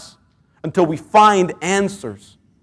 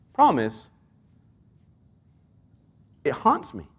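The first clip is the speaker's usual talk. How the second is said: ordinary talk, nothing marked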